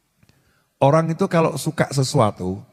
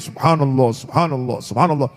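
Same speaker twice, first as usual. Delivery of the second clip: savoured dhikr; 5 semitones lower than usual. Retold or imitated.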